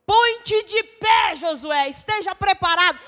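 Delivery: slow, high, loud call to rise; commanding shout. Acted. God shouting slowly to Joshua to get up. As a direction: thunder the command to stand up.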